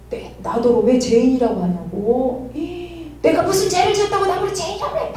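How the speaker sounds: rising into a high, indignant outburst with sharp gasps; angry father. Retold or imitated. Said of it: "화를 내면서". (화난 아버지 흉내, 헉 숨을 들이쉬며 높아지는 항의)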